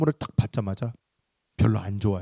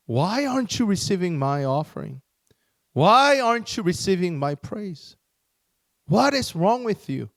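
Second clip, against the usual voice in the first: mildly acted anger, pitch climbing; furious Cain; 5 semitones higher, 3 dB louder, much slower than usual. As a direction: challenge God with rising, measured indignation. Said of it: "Cain got furious".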